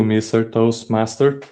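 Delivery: usual speaking voice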